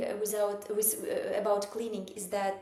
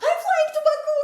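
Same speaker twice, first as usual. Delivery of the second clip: excited; 11 dB louder than usual. Retold or imitated. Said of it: "I was like".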